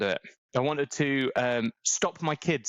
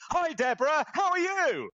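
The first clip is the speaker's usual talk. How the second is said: shouted greeting; 13 semitones higher than usual. Pretend. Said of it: "you're like".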